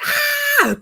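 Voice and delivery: sudden wild scream into the woods, then laughing; primal scream. Pretend. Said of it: "just be like".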